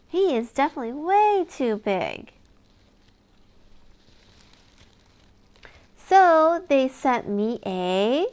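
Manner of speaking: lively storybook reading with big reveals; reading to children. Acted. Reader sharing a lift-the-flap book with a child, animated. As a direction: read it like bedtime story time for a toddler. Reads picture book aloud.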